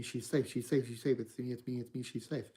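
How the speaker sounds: soft and low, tender reassurance; warm, gentle woman. Acted. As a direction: comfort him gently, almost hushed. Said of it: "a warm, gentle one".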